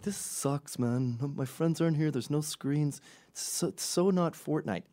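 a hint of kiddish whining; imitated whining kids. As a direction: complain like a bored child. Bored kids complain at beach.

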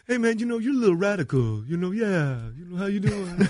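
high, musical [name] voice; [name] impression. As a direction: talk high like a rock singer. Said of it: "he talks like music talk".

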